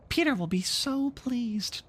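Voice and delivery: high, slow coaxing fairy voice; sly fairy. Acted. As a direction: sweeten the trick. Tink coaxes Toodles.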